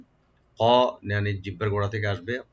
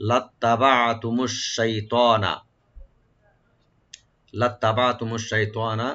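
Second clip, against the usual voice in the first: slow recitation; slower than usual. Shown as a demonstration. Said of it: "লাত্তাবাতুম এখানে কোনো, টান নাই".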